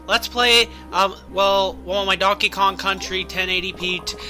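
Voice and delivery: normal talking voice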